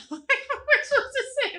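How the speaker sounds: high laughing question. Real, not acted. Laughing at awkward compliment.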